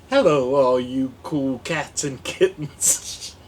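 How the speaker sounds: loud showman greeting; catchphrase impression. Vlogger greeting viewers, loud showman catchphrase. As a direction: greet them like a flamboyant showman.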